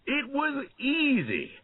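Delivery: high crowing laugh, then gleeful cheer; high-pitched gleeful boast; laughing triumphantly. A narrator's reading. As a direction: laugh and crow over the win. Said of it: "he laughed loudly".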